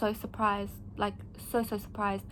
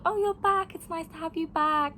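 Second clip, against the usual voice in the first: higher, cheerful welcome-back greeting; welcoming old colleagues; 7 semitones higher than usual. Retold or imitated. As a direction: greet a returning friend with delight. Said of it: "they were like".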